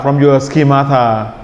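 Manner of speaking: ordinary delivery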